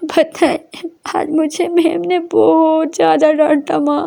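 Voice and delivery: a hint of sobbing as she confides; lightly acted crying. A narrator's reading. Daughter tells mother the teacher scolded her, a hint of tears. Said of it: "रोते-रोते अपनी mummy को हक करती है और बोलती है".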